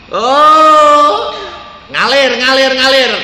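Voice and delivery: loud, delighted outburst; excited exclaim. Retold or imitated. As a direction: cry out with amazed joy. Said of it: "Dia bilang". (seruan gembira yang keras)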